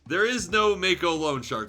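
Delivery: normal talking voice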